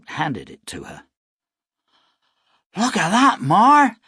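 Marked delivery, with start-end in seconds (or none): 2.8-4.0 high, loud excited shout. Acted. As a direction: call out in wonder. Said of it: "Samuel shouted".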